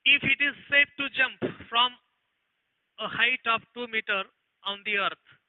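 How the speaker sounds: slow, word-by-word read-out of the question; slow dictation. Acted. Teacher reading an exam question to the class, slow dictation. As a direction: read it out so students can copy it down.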